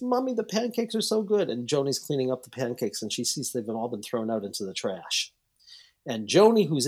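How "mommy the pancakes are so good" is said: high, lightly voiced small daughter; little girl voice. Daughter praising her mother's pancakes, high child voice. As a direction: play the sweet seven-year-old praising mom. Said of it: "so she says".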